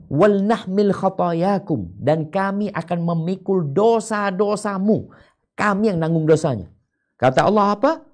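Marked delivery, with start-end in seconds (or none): 0.1-6.7 high, loud, slow mock promise. Preacher voicing disbelievers promising to bear sins, slow. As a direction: promise to carry their sins, slow and grand. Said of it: "Orang-orang kafir berkata".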